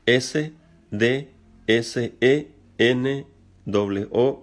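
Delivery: slow spelling, each letter apart; spelled out letter by letter. Shown as a demonstration. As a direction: spell out the Greek word slowly. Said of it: "un poquito difícil de pronunciar". (deletreado lentamente)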